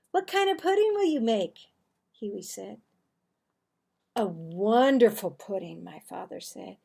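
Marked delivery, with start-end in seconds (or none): 0.1-1.7 put-on high, quick, eager kid; lightly voiced eager small boy; little brother voice. Little brother Huey asking father. 4.2-5.8 louder, big booming dad; a hint of a hearty father; proud father.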